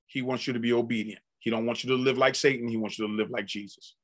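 gentle counsel. Acted. Tender counsel to new convert.

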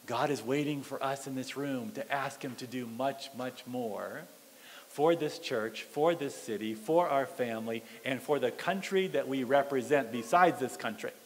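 low, soft and intent; quiet, weighty sermon appeal; earnest hushed preaching. Real, not acted. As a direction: drop right down and appeal to the congregation.

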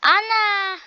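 loud high call for mom. Pretend. Child calling loudly for mom in the game. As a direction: yell up the stairs.

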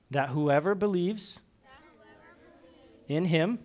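put-on pausing after each phrase for children to repeat; recitation to repeat. Shown as a demonstration. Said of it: "Kids, repeat after me".

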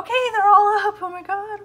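loud, high, rushed squeal of joy; real squealing excitement; excited outburst. Real, not acted. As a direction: burst out with giddy relief. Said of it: "I'm so excited".

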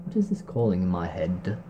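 ordinary delivery, nothing marked

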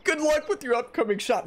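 high, far-off dinosaur voice; T-Rex voice. Acted. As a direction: voice the T-Rex cheering you on. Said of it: "That's my T-Rex voice".

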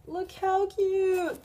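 high, slow gushing coo; high drawn-out delight; gushing over cute. Real, not acted. Showing a cute book to camera.

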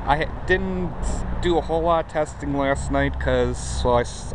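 usual speaking voice

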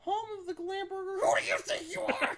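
high, loud strained lizard shout; hissing reptile growl. Acted. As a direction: screech it like an angry reptile. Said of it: "God, my throat hurts".

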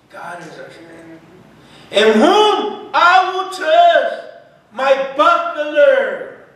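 loud, high-pitched sermon fervor; full-force preaching. Acted. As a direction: preach it to the rafters. Preaching the psalm.

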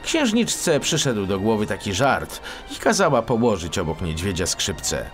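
plain reading voice

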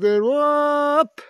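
long, high-pitched whoop; drawn-out high whoop; loud playful whoop. Real, not acted. Calling out as toy is thrown.

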